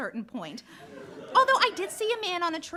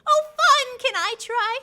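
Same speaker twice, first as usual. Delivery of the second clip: higher, much louder burst of glee; eager delight; 6 semitones higher, 8 dB louder, slower than usual. Acted. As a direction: jump in like a kid offered a game. Florence eager to try knock.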